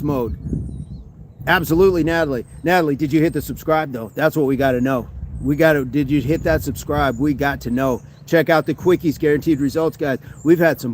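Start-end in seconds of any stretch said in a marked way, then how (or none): none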